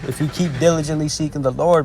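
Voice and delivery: ordinary talk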